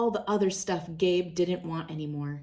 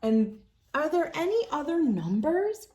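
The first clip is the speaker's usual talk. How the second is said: put-on higher girlish voice, pleading a little; hopeful girl; 7 semitones higher than usual. A narrator's reading. Girl asking coach for another number, hopeful. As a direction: ask it like a hopeful kid. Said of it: "Gabe asked the coach".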